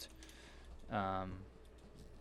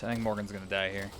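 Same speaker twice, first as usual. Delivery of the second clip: ordinary talk, nothing marked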